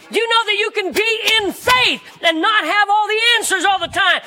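high, emphatic pulpit delivery; full-force preaching. Real, not acted. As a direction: proclaim it with rising conviction.

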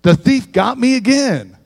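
mock-complain like a cheated man; a hint of an exasperated preacher griping at the devil; grumbling at the devil. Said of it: "I'm like".

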